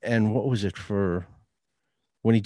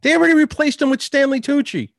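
higher-pitched, worked-up dismissal; exasperated rant; 6 semitones higher than usual. Real, not acted. Friend dismissing interest in a dead TV host, exasperated. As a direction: argue it with rising exasperation.

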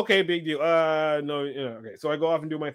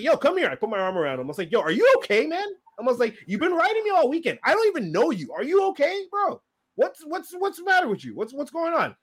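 raised, higher voice confronting him; retold confrontation, heated but held back; snapping at him; 9 semitones higher than usual. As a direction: corner him with a pointed, higher-pitched challenge. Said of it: "This is where I snap".

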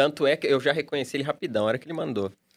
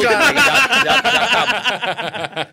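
laughing tease; 11 semitones higher, 8 dB louder, much slower than usual. Real, not acted. Mocking a too-quick finish.

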